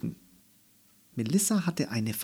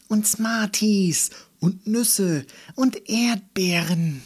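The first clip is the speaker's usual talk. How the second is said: put-on high-pitched gleeful child voice; jubilant enthusiasm; 7 semitones higher, 3 dB louder than usual. A narrator's reading. Melissa dreams up the candy planet, jubilant and enthusiastic. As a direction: pile up treats with mounting delight. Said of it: "Melissa jauchzte begeistert".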